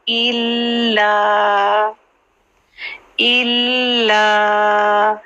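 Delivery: इल्ला with a doubled ल held, slow; Qur'anic recitation. Shown as a demonstration. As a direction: hold the ल in इल्ला. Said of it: "थोड़ा सा hold करना है".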